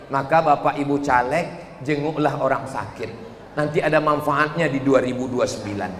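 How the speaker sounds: normal talking voice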